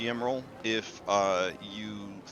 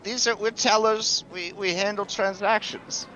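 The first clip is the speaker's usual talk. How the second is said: high, flustered clerk voice; hesitant teller, pitched high; worried bank teller; 10 semitones higher than usual. Acted. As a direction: stall the customer nervously. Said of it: "this worried bank teller says".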